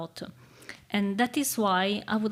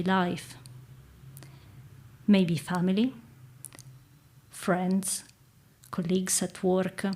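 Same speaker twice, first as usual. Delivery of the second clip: calm meditative tone. Acted. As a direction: guide them gently into stillness. Leads a guided reflection.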